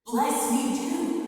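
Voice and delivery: high, slow, drawn-out mock wail; hammed-up, lingering tearful whine. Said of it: "he burst out in tears bitterly".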